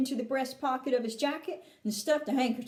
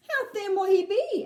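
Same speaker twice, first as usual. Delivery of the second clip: high, louder worried mother; anxious question; 7 semitones higher, 5 dB louder than usual. A narrator's reading. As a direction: ask it fretfully, pitched up. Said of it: "Missus T V anxiously".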